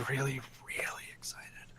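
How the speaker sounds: excited whisper. Real, not acted. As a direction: whisper to hold back shouting. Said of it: "if I don't whisper".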